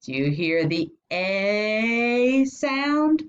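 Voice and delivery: "A" stretched as a high "ay", rising question; long A sound. Shown as a demonstration. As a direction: hold "A" as "ay" and lift the pitch. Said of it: "This is the long sound".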